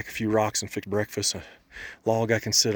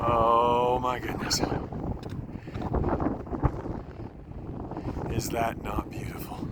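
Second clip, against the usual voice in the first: slow, hushed awe at the view; awed; 6 dB quieter, slower than usual. Real, not acted. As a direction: breathe it out in wonder.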